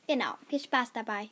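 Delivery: ordinary delivery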